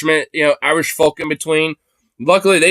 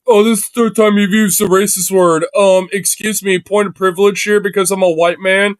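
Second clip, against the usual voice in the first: higher, mock-offended whiny impression of the debater; mocking imitation; 5 semitones higher than usual. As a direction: parrot him back, sneering and higher.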